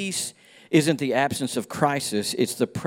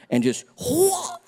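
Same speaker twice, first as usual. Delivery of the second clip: high gleeful whoa with a laugh; slipping whoa; slower than usual. Retold or imitated. Sermon slip story, a laughing high whoa. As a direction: act out the slip on the ice, sliding up high. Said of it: "like that".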